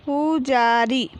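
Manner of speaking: slow, stretched पु, जा, री, falling at the end; drawn-out teaching reading of पुजारी; syllable by syllable. Shown as a demonstration. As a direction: sound out पुजारी piece by piece. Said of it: "तो हम इस प्रकार पढ़ेंगे".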